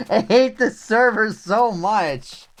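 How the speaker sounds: slow bitter hatred; vitriol. Real, not acted. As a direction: drag it out with venom. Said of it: "Actual vitriol".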